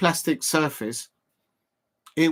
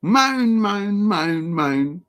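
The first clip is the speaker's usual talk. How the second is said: a mocking whiny moan, higher at first; moaning imitation; 5 semitones higher, 4 dB louder, much slower than usual. Streamer mocks the moaning player with a whiny moan. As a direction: drag out each moan like a complaining player. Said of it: "Always one moaner".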